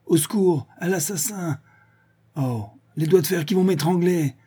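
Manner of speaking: high, hammed-up cries for help; mock terror. A narrator's reading. Old druid pretends Vorsky is strangling him, mock terror.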